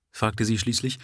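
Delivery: plain reading voice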